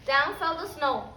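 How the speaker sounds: put-on lively storybook reading for little kids; reading to children. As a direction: read it like story time for a class. Teacher reads picture book aloud.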